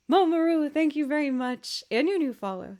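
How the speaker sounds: bright, loud thank you; excited thanks. Real, not acted. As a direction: gush your thanks.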